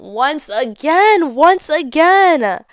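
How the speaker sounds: incredulous, long-drawn repetition; drawn-out disbelief. Acted. Telling viewers she ran into him again, drawn-out disbelief. Exasperated retelling of an unlikely encounter.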